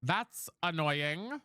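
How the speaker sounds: that's annoying dragged out level; slow, flat drawl. Real, not acted. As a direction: drag it out again, resigned. Drawn out again.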